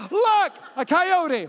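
high, loud, drawn-out 'look!'; imitated excited pointing. Wife on speakerphone spotting a coyote, mock excited cry. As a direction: cry it out like a sighting, hammed up.

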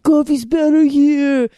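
mock a brash East Coast local; East Coast accent. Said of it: "East Coast assholes being like".

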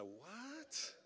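soft, rising squeak of disbelief; mock incredulity. Pastor reacting to officer's awkward question, mock incredulity. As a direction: let it slide up high, quietly baffled.

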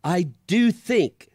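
put-on a tired boy feeling sorry for himself; Shasta, sorry for himself. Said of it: "said Shasta".

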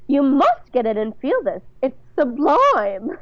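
high, affected society-lady rapture; theatrical mother-in-law delight; gushing matron. Acted. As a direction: gush grandly, stretching the last word. Bunny gushes over the bed.

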